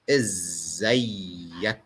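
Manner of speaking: "إزيك" slow, with a long doubled z; "إزيك" drawn out, izzayyak, rising at the end; Egyptian Arabic. Shown as a demonstration. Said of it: "That's why I wrote it twice".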